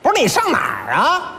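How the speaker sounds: loosely shouted Beijing-dialect question; Beijing accent. Retold or imitated. Beijing sat-nav questions the driver, brusque Beijing accent. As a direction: demand it in casual Beijing speech. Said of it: "北京话导航一开".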